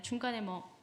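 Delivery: ordinary talk, nothing marked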